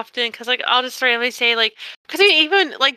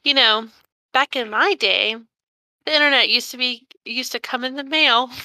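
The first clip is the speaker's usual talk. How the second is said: normal talking voice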